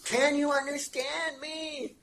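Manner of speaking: high, drawn-out, over-enunciated mock tourist speech. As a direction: stretch every word as if for a foreigner. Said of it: "you're supposed to talk slower".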